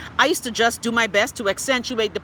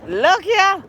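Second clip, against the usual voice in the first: sudden high outburst; 9 semitones higher, 5 dB louder than usual. Real, not acted. Outburst at Coco Plum memory.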